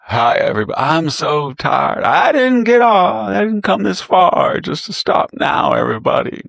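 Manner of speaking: high, loud, slow Southern drawl, mocking Hillary. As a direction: stretch the words in a hammed-up Southern drawl. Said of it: "Hillary, the Southern drawl".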